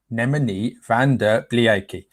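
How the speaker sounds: name sounded out slowly; slow, careful. Real, not acted. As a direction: take the unfamiliar name slowly, piece by piece. Slow second try at name.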